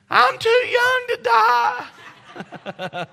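very high, loud, drawn-out wail; imitated scream. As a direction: scream it slowly like a panicked child. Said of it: "she'd scream out".

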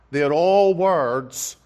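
ordinary delivery

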